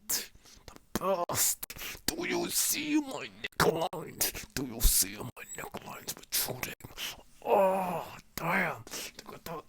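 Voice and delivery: tight, breathy strained whisper. Real, not acted. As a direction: squeeze the words out while tensing the neck. Straining to show neck lines.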